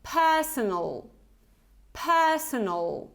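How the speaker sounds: personal, with a reduced schwa in the last syllable; personal, the second take slow and careful; model pronunciation. Shown as a demonstration. Teacher models personal for repetition. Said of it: "repeat after me".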